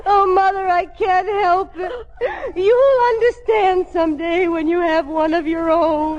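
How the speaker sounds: high-pitched comic bawling; crying. Acted. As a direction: wail helplessly through sobs. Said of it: "please don't cry".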